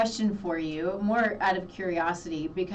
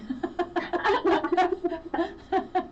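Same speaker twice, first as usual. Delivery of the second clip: bright rising laugh; real laugh; 9 semitones higher than usual. Real, not acted. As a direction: glad happy laugh. Host laughs after story.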